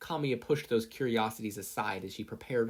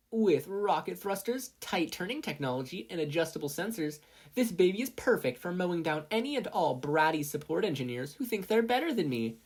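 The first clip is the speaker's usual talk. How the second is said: bratty sales pitch; 8 semitones higher than usual. Acted. Mei advertising the skateboard against Mina, boastfully. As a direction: brag and jab at the rival.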